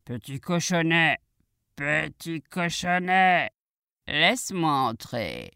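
slow, drawn-out wolf call. Acted. Wolf knocks at the piglet's door, slow drawn-out call. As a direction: stretch the syllables as the coaxing wolf. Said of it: "il s'écria".

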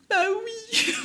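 put-on high, childlike little-girl yes; voix de gamine. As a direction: answer like a small shy child. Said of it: "avec une voix de gamine". (petite voix de gamine aiguë)

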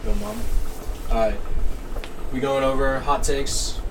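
ordinary delivery

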